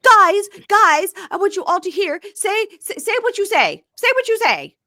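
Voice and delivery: imitating a woman hysterically yelling at her partner. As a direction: shriek at him like you've lost it. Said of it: "hysterically yelling at Josh".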